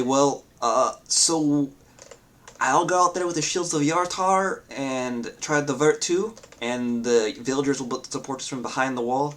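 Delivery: put-on lightly accented captain rallying her troops; NPC accent. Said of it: "I don't wanna speak anymore in her accent".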